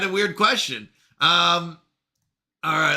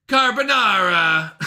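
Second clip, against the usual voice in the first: put-on slow, drawn-out Italian accent; 2 semitones higher, 4 dB louder, slower than usual. Streamer trying carbonara for chat, put-on Italian accent. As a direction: lay on a hammed-up Italian accent. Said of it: "Did I say it with Italian accent?".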